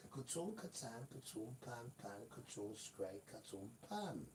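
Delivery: soft mouthed crash noises; tumbling sound effects. Retold or imitated. Adam falling off the mountain, quiet mouthed crash noises.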